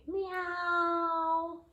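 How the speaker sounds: high drawn-out meow; cat meow. Pretend. Reader meowing as the cat falls. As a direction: mew like the falling cat.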